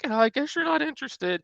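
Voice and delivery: high, whiny oh, I guess you're not interested; mock the guilt-trip line; mock needy salesperson. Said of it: "There's no need to do it".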